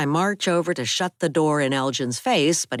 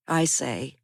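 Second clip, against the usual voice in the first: ordinary delivery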